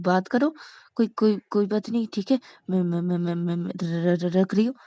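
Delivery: repeated syllables, haltingly flustered; nervous stammer. Acted. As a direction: stammer as if scared to talk. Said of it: "ऐसे हकला क्यों रही हो".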